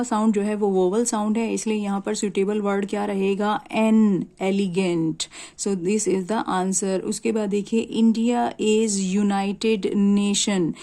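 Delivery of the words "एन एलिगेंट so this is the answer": slow answer. Shown as a demonstration.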